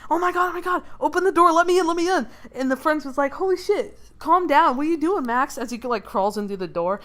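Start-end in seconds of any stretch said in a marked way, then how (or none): 0.1-2.3 high, loud acted panic; freaking out. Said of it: "freaking out". 3.3-5.6 higher, alarmed mock reaction; acted startled friend. Friend startled by frantic Max.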